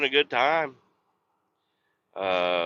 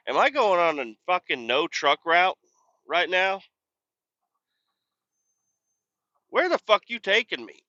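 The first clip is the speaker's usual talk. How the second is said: rising higher and higher in disbelief at the wrong road; who the hell routed me here, pitched up; startled outburst; 7 semitones higher than usual. Real, not acted. Driver confused by road.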